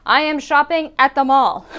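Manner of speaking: stiff mock-formal reply; overly literal answer. Shown as a demonstration. Teacher mocking a literal reply to a greeting. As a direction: answer too literally, for a laugh. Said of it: "I'm not going to say".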